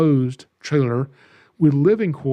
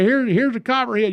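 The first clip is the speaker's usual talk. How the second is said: mock hillbilly; 8 semitones higher than usual. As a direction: lightly play the yokel showing off a snake. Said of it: "redneck hillbilly hick up here".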